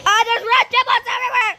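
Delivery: high, loud, fast outburst; alarmed shriek. Real, not acted. Player seeing rat giblets, alarmed shrieking.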